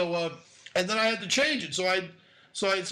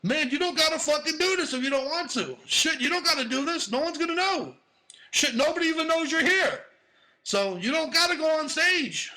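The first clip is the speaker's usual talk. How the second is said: higher, pleading self-talk to chicken out; nervous inner voice; 7 semitones higher than usual. Retold or imitated. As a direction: talk yourself out of it, pitched up. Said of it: "I'm saying to myself".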